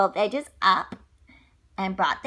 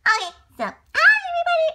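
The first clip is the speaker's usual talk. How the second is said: high, loud, fast and bubbly hello; excited monkey greeting; 9 dB louder, much faster than usual. Acted. George bounding in to greet the kids, excited. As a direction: burst in as an eager little monkey. Playing Curious George to children.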